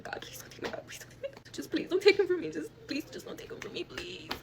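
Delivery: whispered, breaking plea to God; retold desperate praying; tearful prayer. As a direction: beg desperately, slipping between whisper and high cry. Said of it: "I was praying to God".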